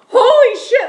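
loud, high retold gasp of disbelief; imitating friends' scandalized shock; shocked outburst. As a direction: blurt it like the whole group just saw it. Retold group shock at photo.

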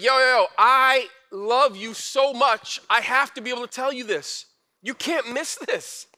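high, loud, impassioned plea; passionate John the Baptist. Acted. Preacher voicing John pleading with people he loves, passionate. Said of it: "he's just so passionate".

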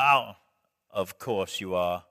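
put-on knowing reply in a Mississippi accent. Customer answering the Boston clerk, Mississippi accent. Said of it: "doing the Mississippi accent".

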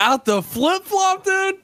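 mock amazement.

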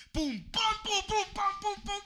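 high, loud pounding noises; mimicked door pounding; imitated banging. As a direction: bang out the knocks with the voice.

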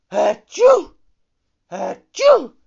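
loud, high-pitched "achoo"; acted sneezes. A narrator's reading. Fire Eater overcome while talking to Pinocchio, loud sneezes. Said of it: "he sneezed twice".

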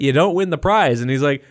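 normal talking voice

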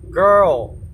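put-on "Girl" gliding from g through r to l; smooth English girl. Shown as a demonstration. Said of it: "この順番に口が滑らかに動いてる". (口を滑らかに動かして英語発音で「Girl」)

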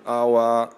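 loud, drawn-out ow; grumpy yelp; grumbled ouch. A narrator's reading. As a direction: complain with a sulky ouch. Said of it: "murrte sie".

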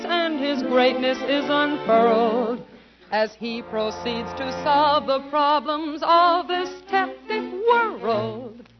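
ordinary delivery

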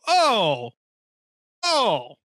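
loud, high offended mobster cry; imitating a disgusted, taken-aback wise guy; mock outrage. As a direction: erupt as if someone crossed the line. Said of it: "so taken aback and disgust".

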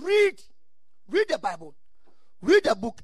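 forceful preaching shout; loud command. Real, not acted. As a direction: bark the order to read. Preacher commanding congregation to read.

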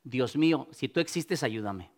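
very soft, low plea; quiet prayer. Retold or imitated. Preacher retells asking God for help, hushed plea. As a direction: recall a weary prayer, voice sinking.

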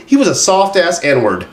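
high, slow mock scorn; retold taunt. Host to customer about the traded player, mock scornful. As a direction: re-enact a scornful jab at a player. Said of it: "exactly how I said it to him".

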